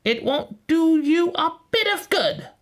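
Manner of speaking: very high, scornful witch voice; scolding witch. A narrator's reading. Witch to praying Gretel, high scornful voice. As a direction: dismiss her plea in a high witch voice. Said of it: "said the witch".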